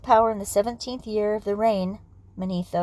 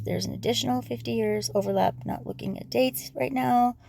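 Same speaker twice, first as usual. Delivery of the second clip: ordinary talk, nothing marked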